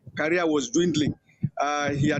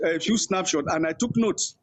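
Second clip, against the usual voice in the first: ordinary delivery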